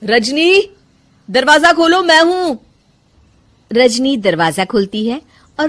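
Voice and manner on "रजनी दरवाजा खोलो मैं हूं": higher, louder call; calling through door. Acted. Husband calling wife to open the bedroom door, loud.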